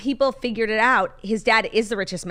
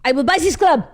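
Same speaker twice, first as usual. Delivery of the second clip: high, loud French heir, very slow and laughing on the repeat; giggling mock French rich kid; French accent; 8 semitones higher, 6 dB louder, much slower than usual. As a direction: stretch the boast out in French. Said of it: "less aggressive".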